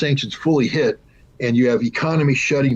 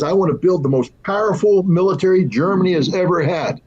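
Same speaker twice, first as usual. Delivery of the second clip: mock proud declaration; lightly voiced Schultz boasting; quoting Schultz; 6 semitones higher than usual. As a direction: announce it like a proud chancellor. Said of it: "when Schultz says".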